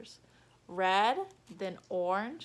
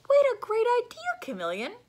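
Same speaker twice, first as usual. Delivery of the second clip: chorus of animals; 13 semitones higher, 9 dB louder than usual. Acted. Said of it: "said the other animals".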